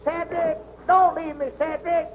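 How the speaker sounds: high-pitched call after a deserter; high, frightened calling; panicked plea. Acted. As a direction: beg him to stay, pitched high. Tied up, being left behind.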